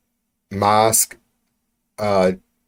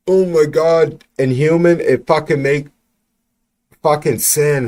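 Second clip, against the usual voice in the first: outraged outburst; 6 semitones higher, 4 dB louder than usual. Real, not acted. Outraged rant about official's comments.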